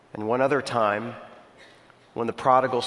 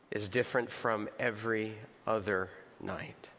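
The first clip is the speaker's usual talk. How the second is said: prayer; 6 dB quieter than usual. Acted. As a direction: pray quietly before the congregation. Pastor leads opening prayer.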